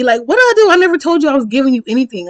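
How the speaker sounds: high-pitched, loud, fast protest; indignant protest. Retold or imitated. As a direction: plead your innocence in mock outrage. Acted protest to being blocked.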